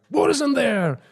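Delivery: high, sudden demanding yell; retold yell. Guru suddenly squeezing a priest's chest, retold yell. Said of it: "he yells".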